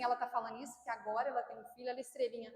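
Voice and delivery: low, soft scoffing aside; mocking doubter. Churchgoer dismissing the preacher as a star, softly scoffing. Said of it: "não pensa assim".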